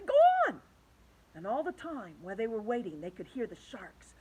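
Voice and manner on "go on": very high, loud frantic urging; all urging James frantically. A narrator's reading. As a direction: push him in desperation. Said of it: "they said frantically".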